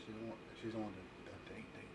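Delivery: whispering. Real, not acted. Whispering to him off mic.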